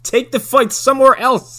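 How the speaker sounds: high-pitched mock calling out; retold yell. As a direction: shout an order across the yard, mockingly. Retold order yelled at fighters.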